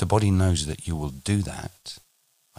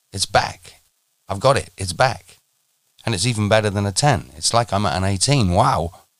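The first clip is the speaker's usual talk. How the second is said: louder, quick, bubbling with excitement; thrilled; 4 semitones higher, 6 dB louder than usual. Acted. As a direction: burst out with joy at getting it back. Student thrilled it's back.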